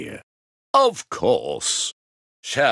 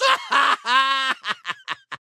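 triumphant evil laugh. Acted. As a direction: throw your head back and cackle. Villain triumphs at last.

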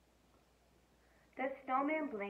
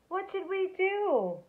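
high, loud, slow worried cry; cried out; 11 semitones higher, 6 dB louder, slower than usual. A narrator's reading. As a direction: call out in alarm. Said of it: "The children cried out".

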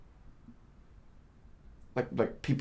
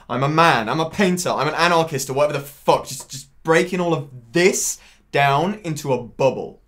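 loud, higher-pitched tirade; impassioned rant; 4 semitones higher, 7 dB louder than usual. Acted. Man railing at his need to be an individual, worked-up rant. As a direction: pour it out loudly, worked up. Monologue, ranting about his own ego.

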